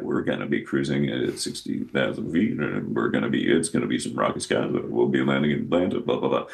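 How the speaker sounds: low, casual airline captain on the intercom; airline pilot announcement. Retold or imitated. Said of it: "When they would talk like that".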